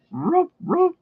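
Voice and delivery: very high yappy cartoon barks; playful pup barking; dog bark. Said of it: "I could do him talking, as in".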